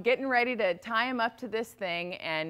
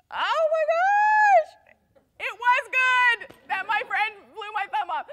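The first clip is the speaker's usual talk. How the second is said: much higher and louder, crying out in wonder; astonished realization; 10 dB louder, slower than usual. Acted. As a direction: gasp it out as the truth hits. King realizing why he was spared.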